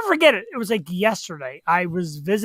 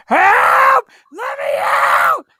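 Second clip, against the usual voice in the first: high, loud cry for help from inside a car trunk; shouting for help; 6 dB louder, much slower than usual. Retold or imitated. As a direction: yell it out like someone trapped. Man locked in trunk calls out.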